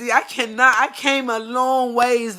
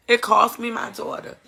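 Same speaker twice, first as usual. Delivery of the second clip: ordinary talk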